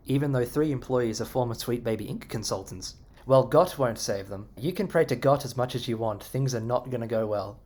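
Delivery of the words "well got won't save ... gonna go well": ordinary talk, nothing marked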